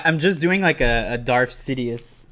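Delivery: normal talking voice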